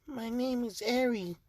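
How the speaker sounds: put-on shy little girl, high and soft; small, timid high child voice; Eri. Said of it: "nervous and shy".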